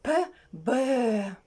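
П puffed short, Б held long; sound demonstration. Shown as a demonstration. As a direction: say П, then stretch out Б. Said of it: "вимовляти звуки". (розмірена вимова звуків П, Б)

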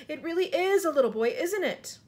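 high, amazed exclamation; astonished cry. A narrator's reading. Fire chief recognising a small boy, amazed cry. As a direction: marvel aloud in disbelief. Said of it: "cried the head of the fire department".

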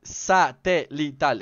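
"Satelital" very slow, syllable by syllable; careful pronunciation. Shown as a demonstration. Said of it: "Es un poquito complicado pronunciar esa palabra". ("Satelital" muy lento, sílaba por sílaba)

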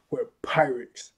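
slow, whispery last words; fading, dragged-out whisper; about to pass out. Retold or imitated. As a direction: trail into a whisper as consciousness goes. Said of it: "Right before Zoro passes out".

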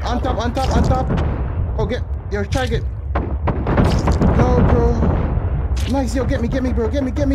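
high loud urgent yelling; shouting for help. Real, not acted. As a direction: yell for your teammate. Calling teammate for help.

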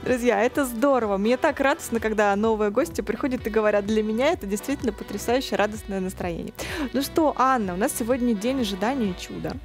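usual speaking voice

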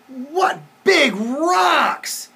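high, very slow storybook wonder; amazed exclamation. A narrator's reading. Dinosaur sees buildings as rocks, amazed exclamation. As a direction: draw out each word in amazement.

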